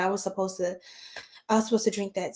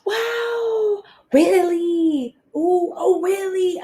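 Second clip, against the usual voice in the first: much higher, gushing disbelief; delighted surprise; 9 semitones higher, much slower than usual. Real, not acted. Reader thrilled by the cards she drew, high squeals.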